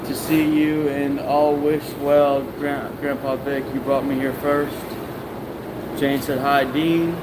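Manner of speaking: usual speaking voice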